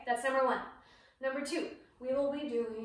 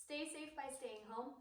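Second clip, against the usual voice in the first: soft, hushed closing line; quiet sign-off; 15 dB quieter than usual. Shown as a demonstration. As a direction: say it gently and softly.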